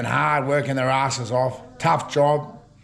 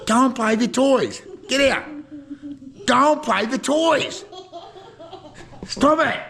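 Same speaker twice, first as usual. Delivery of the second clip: laughing shoo; 12 semitones higher than usual. Real, not acted. Grandfather shooing Henry off to his toys, laughing.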